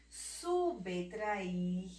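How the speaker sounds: subtrair drawn out as "su-be-tra-ir"; "Subtrair" in careful teaching syllables; mute B sounded. Shown as a demonstration. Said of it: "O B mudo".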